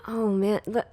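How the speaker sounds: normal talking voice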